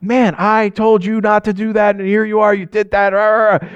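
exasperated acted telling-off; angry parent. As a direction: imitate a dad telling off his son, trailing into blah-blah ranting. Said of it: "I get angry over it".

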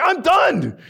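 high fed-up outburst; acted exasperated shout; Paul. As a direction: declare it like patience has just run out. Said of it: "that's what Paul was like".

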